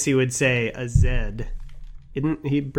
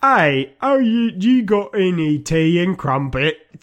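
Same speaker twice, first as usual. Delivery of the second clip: mock posh British; 5 semitones higher, 3 dB louder, slower than usual.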